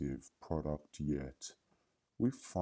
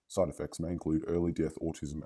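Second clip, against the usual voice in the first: fast disclaimer; faster than usual. Acted. Rushed side-effects disclaimer.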